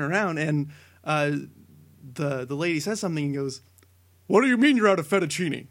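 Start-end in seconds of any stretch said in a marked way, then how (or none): 4.3-5.7 Barack Obama, indignant. Retold or imitated. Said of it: "You can hear this guy's voice".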